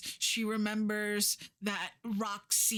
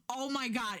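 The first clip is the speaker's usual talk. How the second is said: normal talking voice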